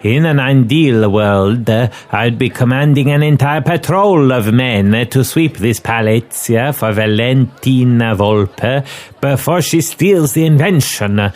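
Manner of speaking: lightly voiced foreign inspector, measured and deliberate. Inspector explains the denied squadron request to his helper, lightly voiced officiousness. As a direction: play an earnest inspector briefing his helper.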